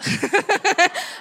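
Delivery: bursts out laughing; a real laugh, high. Real, not acted. Mom laughing at son's question, real laugh.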